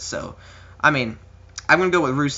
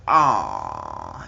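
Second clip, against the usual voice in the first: mock groan of disappointment. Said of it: "Everybody's like".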